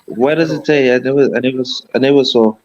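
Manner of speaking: ordinary talk, nothing marked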